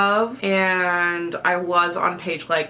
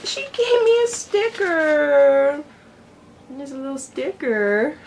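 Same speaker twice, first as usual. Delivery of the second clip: excited; 8 semitones higher, 4 dB louder than usual. Real, not acted. Unboxing delight.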